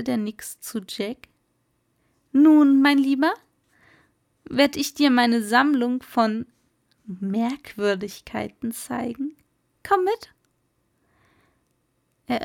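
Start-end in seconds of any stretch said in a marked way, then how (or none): 2.3-10.3 a proud host showing off his collection; jovial old merman. Acted. Old merman inviting Jack to see his curiosities, hearty.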